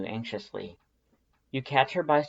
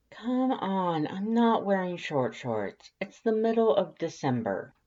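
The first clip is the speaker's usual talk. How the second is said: lightly voiced young woman, higher, calling through a door; 8 semitones higher than usual. A narrator's reading. Through the bathroom door to Undyne, a mildly protesting higher call. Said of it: "you call from the other side of the bathroom door".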